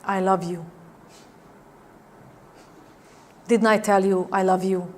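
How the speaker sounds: quiet tender declaration; soft I love you. Shown as a demonstration. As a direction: say it gently, almost under the breath. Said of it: "you can say someone".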